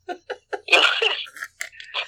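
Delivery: breathy self-mocking laugh; embarrassed laugh. Real, not acted. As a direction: laugh at yourself, high. After calling himself selfish.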